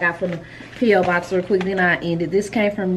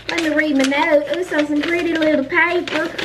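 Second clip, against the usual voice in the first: much higher, bright and eager; delighted repeat; 8 semitones higher than usual. Real, not acted. Vlogger opening a viewer's note, high excited repeat. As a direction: lift the whole line up with delight.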